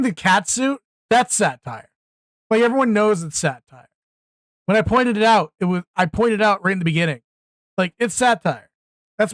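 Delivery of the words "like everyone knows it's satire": usual speaking voice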